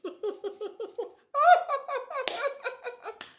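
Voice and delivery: sister's big high laugh; high cackling laugh. Retold or imitated. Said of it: "my sister's in the car going".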